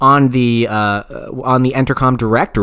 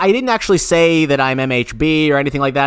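ordinary talk

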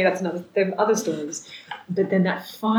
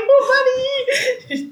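much higher, laughing through it; laughing squeal; 5 dB louder, much slower than usual. Retold or imitated. Bride reacting to the rescued passport, high laughing squeal. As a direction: squeal it with relief.

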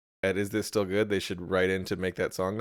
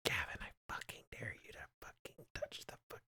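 whisper; 18 dB quieter than usual. Pretend. Whispered dare game.

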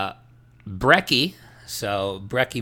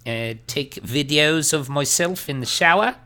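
put-on terrible Australian accent as Bitlord; 3 semitones higher than usual. Host keeps playing Bitlord in a mock Australian accent. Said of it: "great impression".

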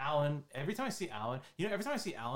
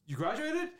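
high, louder stunned question; amazed question; 13 semitones higher, 5 dB louder than usual. Retold or imitated. Asking a friend if he really graduated, high amazed. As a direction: ask it in joyful disbelief. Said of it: "I was like".

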